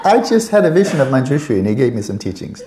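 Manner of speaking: loud, high mock Aussie kid; Australian kid. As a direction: proudly announce it in a hammed-up Australian child voice. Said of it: "Aussie kid saying".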